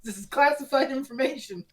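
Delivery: mock warning. Retelling the story to co-hosts as Brazen, mock warning. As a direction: put on an insistent cover-up voice. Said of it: "Brazen is basically just like".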